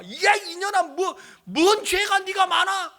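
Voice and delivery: loud, high outburst; retold mother's scolding. Mother to her sick daughter, indignant loud scolding.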